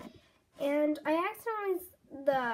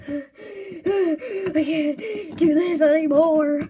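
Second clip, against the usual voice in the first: exasperated gasp; 8 dB louder, much slower than usual. Acted. Giving up on the dare, gasping.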